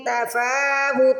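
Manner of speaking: تفاوت with the fa held long, very slow; slow Quranic recitation. Shown as a demonstration. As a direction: stretch the fa in تفاوت. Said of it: "فا کو کھینچ کے ادا کریں گے".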